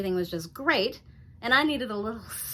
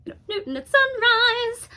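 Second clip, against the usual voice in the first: very high, loud, thrilled exclamation; starstruck squeal; 14 semitones higher, 11 dB louder than usual. Acted. Housewife spotting a radio host in her audience, starstruck. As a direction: squeal the show's name in excitement. Starstruck repeat of show's name.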